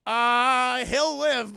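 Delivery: long, loud hesitation into a high pronouncement; acted booming hedge; loud verdict. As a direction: announce a grim prognosis loudly. Jason Isaacs' verdict acted.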